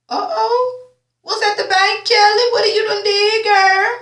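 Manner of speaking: put-on high sassy girl voice; teasing gossip. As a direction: needle her about the secret. Teasing Kelly's secret.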